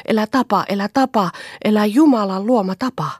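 high loud terrified begging; high desperate plea not to be killed; pleading cry. A narrator's reading. Beaten man begs for life.

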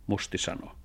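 ordinary delivery, nothing marked

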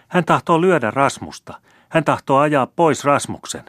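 put-on a girl shouting to her mother, high and loud; girl's shout. A narrator's reading. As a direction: cry it out to mother in alarm. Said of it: "huusi tyttö".

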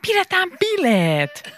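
high, drawn-out call; gleeful high exclamation; excited announcement. A narrator's reading. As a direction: announce the party with glee. Krista proposes a party.

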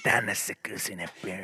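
slow, soft sulky grumble; slow low grumble. Acted. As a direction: mumble it slowly under your breath. Hidas hiljainen mutina. (hidas, hiljainen mutina)

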